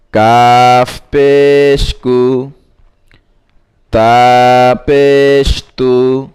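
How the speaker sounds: measured, chanting recital of spelled syllables; recitation drill. Shown as a demonstration. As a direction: read each syllable out slowly like a teacher drilling a class. (ধীরে ধীরে সুর করে বানান পড়া)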